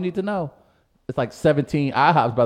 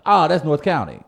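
St. Louis local voice; 6 semitones higher, 7 dB louder than usual. Retold or imitated. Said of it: "that's how we describe things".

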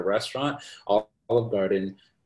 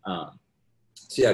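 ordinary talk, nothing marked

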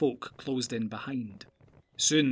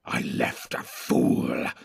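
low slow hissed snarl; low orc snarl, slowly; 12 semitones lower, much slower than usual. Acted. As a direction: spit the words out, much lower. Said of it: "snarled Grishnak".

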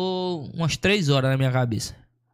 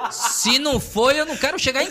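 breaks into a high, breathless laugh; cracking up mid-sentence; real laughter; 9 semitones higher than usual. Real, not acted. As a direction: laugh through the words, slowed right down. Laughing at own vibrator story.

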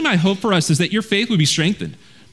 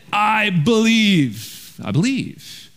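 slow, drawn-out mock declaration; hollow claim of belief; much slower than usual. Preacher mocking people who only say they believe, drawn out slowly. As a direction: stretch it out like an empty boast.

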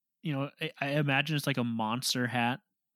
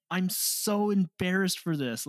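flustered embarrassment; 6 semitones higher than usual. Real, not acted. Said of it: "I was beat red".

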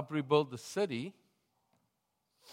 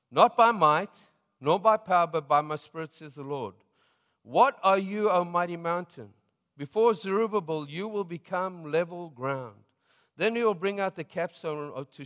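louder, higher proclamation of scripture; preaching scripture; 4 semitones higher, 5 dB louder than usual. Acted. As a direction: proclaim it like a prophet to the crowd. Reads prophecy at preaching force.

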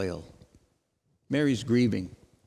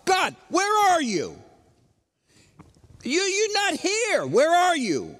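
crying out to God; 10 semitones higher than usual. Acted. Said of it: "we cry out to God".

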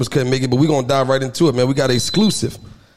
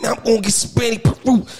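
imitating little brother; 9 semitones higher than usual.

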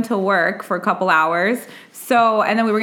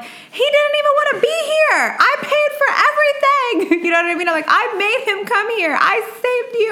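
very high, pitched-up emotional rant; retold going off; 14 semitones higher than usual. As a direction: go off at dad, voice cracking high. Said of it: "I went off".

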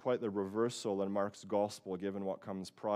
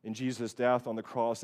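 ordinary delivery

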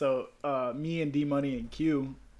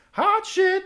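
much higher and louder cheer; mock cheer; 10 dB louder than usual. Cheering on the wrecked car, much higher and louder. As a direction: whoop it like a hype man.

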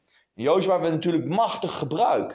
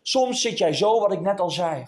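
ordinary delivery

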